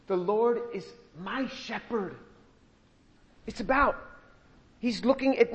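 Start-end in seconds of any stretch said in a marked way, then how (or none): 0.1-2.1 hushed. Shown as a demonstration. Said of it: "But he says".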